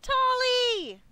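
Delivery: high, loud delighted cry; excited call. Acted. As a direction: call out a name with sudden joy. Player greets running companion excitedly.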